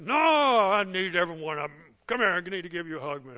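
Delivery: loud, fast, booming welcome; jovial yell to an old teacher; hearty shout. Retold or imitated. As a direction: call it out like a big bear hug. Acted hearty reply, shouted.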